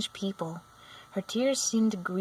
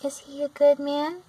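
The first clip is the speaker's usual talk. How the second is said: anxious; 7 semitones higher, 5 dB louder than usual. A narrator's reading. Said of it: "inquired the girl anxiously".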